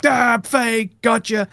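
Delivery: high-pitched mock exposé cry; mock gotcha. Imitating viewers spotting a slipped accent, mock triumphant gotcha. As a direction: catch out a faker, high and teasing. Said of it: "people go like".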